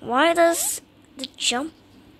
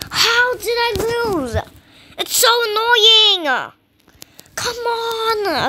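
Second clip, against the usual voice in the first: high, loud whine; exasperated sore-loser wail; frustrated wail; 5 semitones higher, 5 dB louder than usual. Real, not acted. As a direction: whine loudly as if near tears. Loses again, annoyed.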